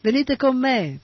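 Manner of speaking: high-pitched excited shout. A narrator's reading. Hippie boy leaps up calling the devotees, high shout.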